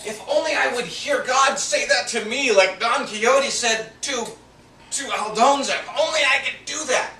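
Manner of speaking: reliving a tearful wish; retold crying plea. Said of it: "sat in my living room crying and said".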